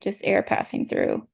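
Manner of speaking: whispered, no voice; breathy air only. Shown as a demonstration. Teacher demonstrating voiceless airflow, whispered. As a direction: let just air through, unvoiced. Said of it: "So no movement".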